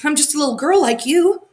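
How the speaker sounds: high, loud and scared; petrified girl, pitched high; frightened squeak. A narrator's reading. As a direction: plead it in a little squeak. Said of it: "squeaked the petrified Gerda".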